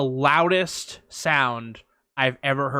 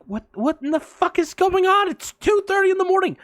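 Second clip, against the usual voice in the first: much higher, louder outraged squeal; high outraged disbelief; 3 dB louder than usual. Retold or imitated. Woken by fireworks at night, high outraged disbelief. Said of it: "And I'm like".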